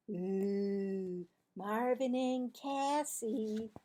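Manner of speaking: drawn-out slow ooh; teasing kid. Acted. Judy teasing Marvin and Cassie, slow sing-song. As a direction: tease the pair.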